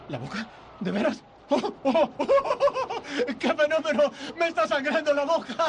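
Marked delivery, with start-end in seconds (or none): 1.5-5.6 delighted laughter. Acted. George thrilled that his mouth bleeds again, laughing giddily. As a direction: burst out laughing with giddy joy.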